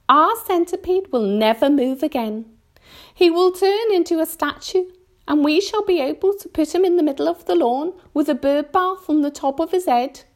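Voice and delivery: high, cheerful earthworm; said brightly. A narrator's reading. Earthworm foresees the centipede as a statue, said brightly. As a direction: predict doom with bright relish. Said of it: "the earthworm said brightly".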